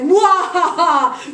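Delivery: loud, slow evil cackle; villain laugh. Acted. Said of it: "So he's like".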